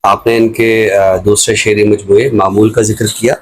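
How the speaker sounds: usual speaking voice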